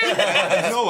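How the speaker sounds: ordinary delivery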